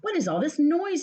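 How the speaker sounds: running up, startled by the noise; surprised question. Acted. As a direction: Frog puzzled by Toad's shouting. Frog hurries to the noise.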